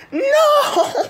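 shrieking high no with a laugh; anguished scream. Real, not acted. Play fails, screaming.

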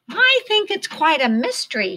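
high, chirpy bird voice; chirped. A narrator's reading. Flap chirping about the mystery, high chirpy voice. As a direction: chirp it out brightly. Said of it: "Flap chirped".

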